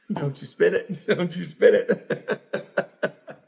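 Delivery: cracking up mid-sentence, words squeezed out high; losing it to giggles, barely getting words out; laughing. Real, not acted. Host teasing cohost while both crack up laughing.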